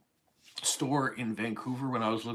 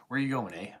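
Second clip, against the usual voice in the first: louder question with "eh" tacked on the end; Canadian "eh" tag; 3 semitones higher, 6 dB louder, faster than usual. Shown as a demonstration. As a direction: tack "eh" on like a spoken period. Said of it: "people that say "a" a lot more".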